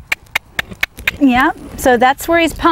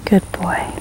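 whisper; 3 semitones lower, 5 dB quieter than usual. Real, not acted. Soothing the nervous horse.

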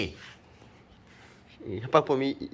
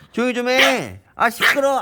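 higher, louder hushing over the barking; yelling to hush; 10 semitones higher, 5 dB louder than usual. Real, not acted. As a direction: shout the dog down. (높고 크게 조용히 하라고 외침)

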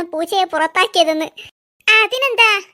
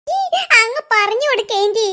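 ordinary talk

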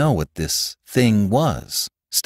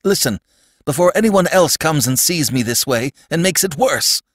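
urgent high-pitched appeal; pleading; 8 semitones higher than usual. A narrator's reading. Sandman afraid of being seen this way, pleading. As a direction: beg him to listen. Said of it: "pleaded the Sandman".

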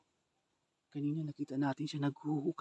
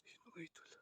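unvoiced urgent whisper; whispering while hiding; 3 semitones higher, 18 dB quieter than usual. Real, not acted. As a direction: whisper the plan to hide. Hiding, watching him.

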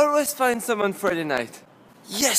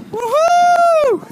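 high, loud whoop; joyful yell; 9 dB louder than usual. Acted. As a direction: whoop with joy.

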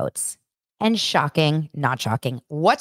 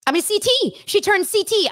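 excited. Real, not acted. Correcting herself, stunned by twist.

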